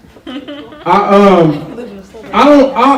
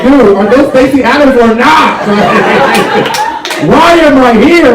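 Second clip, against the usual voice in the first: higher, louder fed-up plea; exasperated; 9 semitones higher, 3 dB louder than usual. Retold or imitated. Student fed up with Griffith's rambling, exasperated. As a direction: beg for a straight answer. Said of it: "And you're like".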